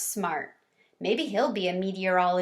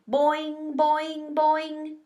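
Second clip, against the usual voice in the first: raised, springy boing like a hopping frog; a lightly voiced boing noise, each higher than plain; 7 semitones higher, much slower than usual. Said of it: "made his usual weird sound".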